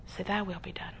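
soft, hushed whisper of yielding; softly whispered prayer; whispered surrender prayer. Shown as a demonstration. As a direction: breathe the words out as a quiet prayer. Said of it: "You gotta say".

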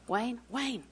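softly imitating a call to a sleeping husband; hushed calling. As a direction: call the name quietly, as if in the night. Acts waking husband at night.

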